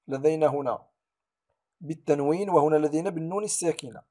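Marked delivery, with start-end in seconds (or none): none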